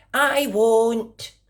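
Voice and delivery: put-on high, childlike promise; little girl voice. A narrator's reading. Girl promising her mummy, high and earnest. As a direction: sound like a small girl promising.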